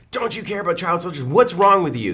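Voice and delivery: high, scolding mock indignation; self-righteous. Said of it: "this kind of self-righteous tone of voice".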